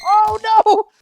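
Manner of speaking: very high, loud cry; loud wail of dismay. Real, not acted. Player watching a mishap in game, loud dismayed wail. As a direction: wail it at full volume.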